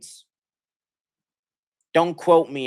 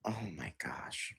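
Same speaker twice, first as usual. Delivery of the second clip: despairing whisper; 2 semitones lower, 18 dB quieter than usual. Real, not acted. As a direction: whisper it like you can't believe it.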